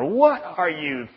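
high, loud, outraged scolding; acted frantic telling-off, words stretched out; screaming parent. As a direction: scream at a child after a scare. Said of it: "you're screaming at her".

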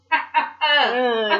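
slow, drawn-out fake laugh; the crowd mockingly laughing at her; mock crowd laugh. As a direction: drag out a jeering ha ha ha. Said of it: "everyone's like".

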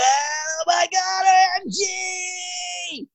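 very high, drawn-out commentator wail; Ryle Nugent. Acted. Mimic doing a rugby commentator, high-pitched wail. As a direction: stretch every word into a soaring cry. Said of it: "even Ryle Nugent is gone now as well".

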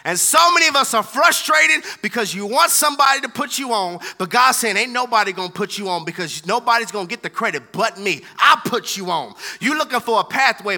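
loud, driving pulpit delivery; preaching at full force. Acted. Pastor firing up his congregation, loud preaching. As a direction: preach it hard to the whole room.

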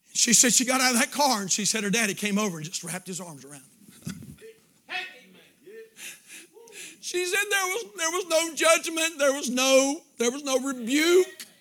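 high, loud, building preacher's cry; climbing revival sermon voice; rising preaching. Acted. Preaching at full force.

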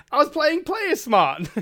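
high, slow mock-innocent protest; innocent excuse. Player defending himself to his group, high and mock-innocent. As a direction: play dumb to the annoyed party. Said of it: "And I was like".

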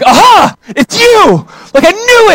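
loud, high-pitched gotcha shout; triumphant accusation. Retold or imitated. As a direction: catch the culprit red-handed, voice leaping up. Acts out catching the thief.

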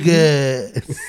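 ordinary delivery

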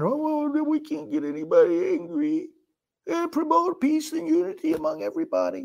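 high-pitched mocking wimp voice; mock the timid peacemakers with a whimper. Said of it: "You don't go wimping around".

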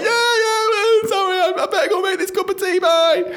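very high, loud squealing backpedal; mock flustered apology. Embarrassed worker excusing himself from the dentist, mock flustered. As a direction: squeak out a panicky excuse and flee. Acted flustered escape after blunder.

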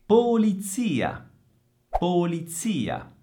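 high, slow POLIZIA, ts Z, long stressed I. Shown as a demonstration. Teacher models accent and Z, slow stressed POLIZIA. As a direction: model POLIZIA slowly, leaning on ZI. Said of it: "Attenzione all'accento e attenzione al suono della Z".

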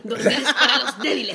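playing little Diego. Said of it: "una muy buena interpretación de mí".

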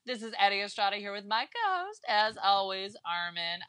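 big, high announcer opening settling down. Pretend. Host welcoming listeners to the podcast, announcer voice. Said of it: "like, announcer voice today".

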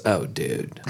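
retold low, quiet oh dude; hushed dismay. Friend reacting to nacho spill.

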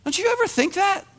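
fired-up pulpit delivery; full-force preaching. Acted. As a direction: preach it hard to the crowd.

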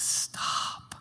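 soft breathy stop, barely voiced; a hushed, tender Stop; whispered stop. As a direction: hush them gently with the word Stop. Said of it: "Paul's like".

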